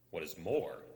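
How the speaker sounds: ordinary delivery, nothing marked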